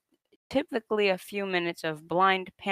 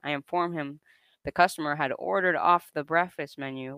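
ordinary talk, nothing marked

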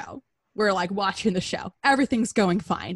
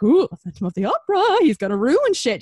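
mocking spooky tone; 5 semitones higher, 4 dB louder than usual. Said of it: "just to make fun of him".

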